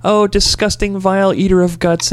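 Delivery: put-on high, sing-song baby talk to a cat; doting pet talk. Petting the cat, cooing mock insults in high baby talk. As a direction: coo insults lovingly in a high voice. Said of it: "and I'd go".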